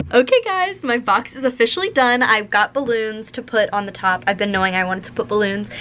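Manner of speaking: ordinary talk, nothing marked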